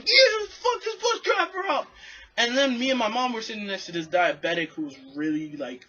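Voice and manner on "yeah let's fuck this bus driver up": put-on very high shouted kid voice; kids yelling. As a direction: screech it like a small child joining in. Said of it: "even the kids are going".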